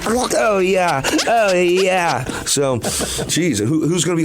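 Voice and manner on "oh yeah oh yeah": high, drawn-out mock yeah; put-on high-pitched gloating; weatherman wooing ladies. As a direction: stretch each word out like a comic catchphrase. Acted smug weatherman wooing ladies.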